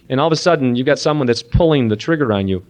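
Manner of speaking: ordinary delivery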